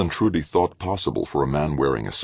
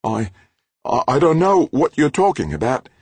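much higher, louder, frightened stammer; sputtering; 13 semitones higher, 6 dB louder than usual. A narrator's reading. Halleck sputtering denials at Grant, high and frightened. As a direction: stumble over the words in fear. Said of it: "but she sputtered".

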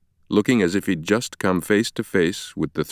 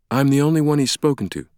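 higher-pitched, composed explanation; take-charge woman doctor; 8 semitones higher, faster than usual. Acted. Sienna explains Langdon's amnesia to Ferris, taking charge. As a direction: step in and take control.

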